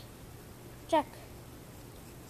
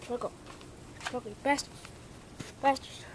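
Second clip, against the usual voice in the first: ordinary talk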